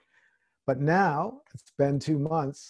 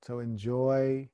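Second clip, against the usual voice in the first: guided meditation; 2 semitones lower, 5 dB quieter than usual. Acted. Meditation close.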